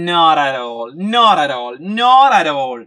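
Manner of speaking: "not at all" flowing as one word, with the t's softened; linked not at all. Shown as a demonstration. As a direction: glide the words into each other, not a-tall. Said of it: "yes, ഇങ്ങനെ പറഞ്ഞേ".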